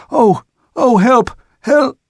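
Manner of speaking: put-on high, loud frightened old sailor's cry. A narrator's reading. Old sailor clinging to rigging, crying in terror. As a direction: cry for help, terrified. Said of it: "shout in a frightened voice".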